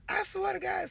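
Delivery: put-on high child voice swearing it's true; pleading child. Daughter swearing to mom it's real, high pleading voice. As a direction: insist like a scared kid. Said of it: "she like".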